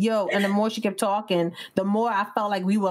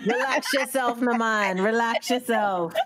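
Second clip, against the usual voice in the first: raised, drawn-out Jamaican lilt; put-on Jamaican; 4 semitones higher, slower than usual. Relaxed vacationer tells worried tourists to relax, Jamaican lilt. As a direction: soothe them in playful mock Jamaican.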